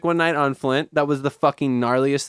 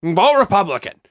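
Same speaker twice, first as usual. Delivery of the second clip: higher, louder mock panic like a TV alarmist; mocking fearmongering pundit; 6 semitones higher, 6 dB louder than usual. Mocking TV news pundits warning of a warhead, loud mock alarm. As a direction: ham up cable news scaremongering. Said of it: "everyone's like".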